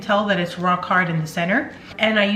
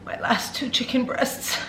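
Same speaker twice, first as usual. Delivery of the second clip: mock sad whisper; 4 semitones higher, 7 dB quieter than usual.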